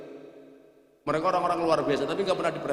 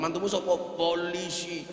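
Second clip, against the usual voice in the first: put-on swagger, ending softer; mock showing off; 4 dB quieter than usual. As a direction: imitate a boastful in-law. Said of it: "gayanya minta ampun".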